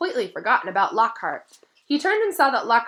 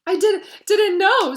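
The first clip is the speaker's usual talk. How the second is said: high, loud and slow through tears; sobbing; 12 semitones higher, 10 dB louder, slower than usual. A narrator's reading. Ginny answering her mother, slow tearful sobs. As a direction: sob the words out. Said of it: "sobbed Ginny".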